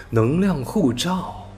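ordinary delivery

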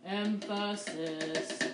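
Emphasis stretched very slow, every syllable lingered; Emphasis drawn out as a fun word to say. Shown as a demonstration. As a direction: savor the word Emphasis, slowly. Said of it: "It's fun to say that word like that".

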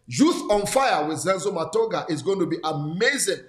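usual speaking voice

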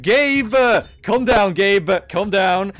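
loud, high exclamation; excited calling out. Real, not acted. Streamer reacting to a viewer's donation, loud excited calling out. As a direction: call out to rein someone in.

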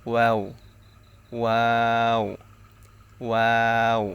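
ওয়াও with both lips rounded; careful demonstration of round-lipped ওয়াও; rounded-lip letter. Shown as a demonstration. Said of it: "দুই ঠোঁট গোল করিয়া".

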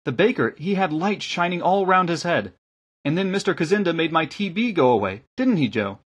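put-on high, lilting girl's voice; light young voice, dropping on the question; village girl. A narrator's reading. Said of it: "the girl from the village told them".

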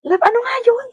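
exasperated asking. Retold or imitated. Partner begging love for the song title, high exasperated whine. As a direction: whine it out like you can't stand forgetting.

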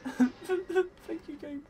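very high, squeaky emotional thanks. Real, not acted. Player thanks the game after winning, emotional high squeak. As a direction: squeak it out, overcome with relief. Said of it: "Oh, I'm getting emotional".

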